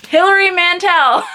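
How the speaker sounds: high, loud laugh on the name. Real, not acted. Vlogger laughing as she holds up the chunkster, laughing. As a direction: burst out laughing as you name it. Laughs naming the huge book.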